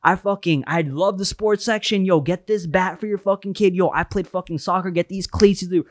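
high-pitched enthusiastic sales patter; happy, energetic. Retold or imitated. New store worker chatting to customers, high-pitched eager excitement. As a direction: gush like a thrilled new employee. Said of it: "I was just as energetic".

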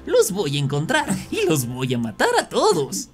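high, sinister villain voice; sinister Barney. Acted. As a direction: taunt the children with evil glee. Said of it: "sonrió malignamente y dijo".

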